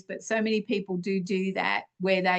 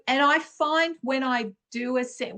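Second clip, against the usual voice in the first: ordinary talk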